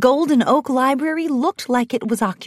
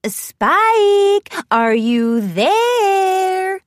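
high, long-drawn singsong call; calling through a window in a high trill; 9 semitones higher, 4 dB louder, much slower than usual. A narrator's reading. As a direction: trill it out sweetly, stretching the last word high. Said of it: "Rarity trilled into one of the front windows".

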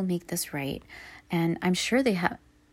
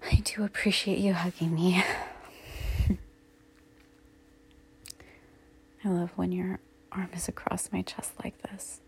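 soft, unvoiced, softer whisper of thanks; tender whisper; 4 dB quieter than usual. Hugged from behind by partner, tender whisper. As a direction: whisper gently while being hugged.

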